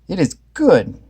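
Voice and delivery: lightly pleased Velma; Velma agreeing. A narrator's reading. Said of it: "Velma agreed".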